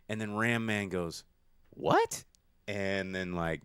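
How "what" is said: very high, startled "What?"; baffled squeak. Retold or imitated. Ram Man reacting to Fisto, shocked high squeak. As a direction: squeak it out in total confusion.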